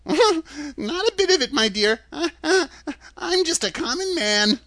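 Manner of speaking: put-on very high, tittering old-man voice; sheepish, high-pitched little fellow; giggly little man. A narrator's reading. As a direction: confess cheerfully between nervous chuckles.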